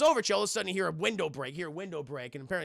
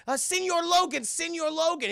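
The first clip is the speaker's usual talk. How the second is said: high, louder pleading voice; mock pleading woman; 12 semitones higher, 4 dB louder than usual. Narrator voicing the Mexican lady calling Logan, high. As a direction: call out to him in a high put-on voice. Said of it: "And she's like".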